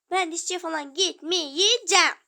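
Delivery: shouted, defiant no; loud refusal. Acted. Child refusing the dentist to her mother, loud. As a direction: yell it, refusing flatly.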